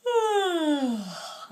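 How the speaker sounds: long open yawning sigh; big yawn. Shown as a demonstration. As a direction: yawn it out wide. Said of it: "give me a really big yawn".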